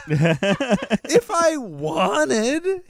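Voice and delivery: very high, drawn-out protest; comic high-pitched insisting; playful insistence. Real, not acted. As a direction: stretch it out high, defensively. Defensively insisting about dad friendship.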